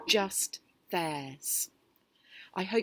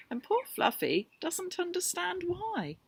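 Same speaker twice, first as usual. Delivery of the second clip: usual speaking voice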